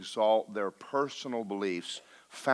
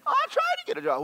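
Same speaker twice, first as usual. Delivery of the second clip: high whine; 4 dB louder, faster than usual. Pretend. The thief answers back.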